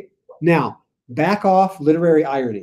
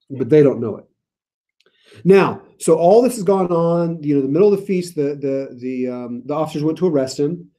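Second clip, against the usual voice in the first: ordinary delivery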